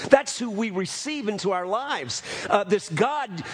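ordinary talk, nothing marked

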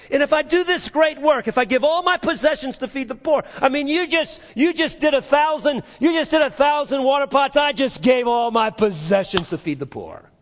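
high, loud preaching climax; fervent preaching. Acted. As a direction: lift it up and drive it home. Preaching at full force.

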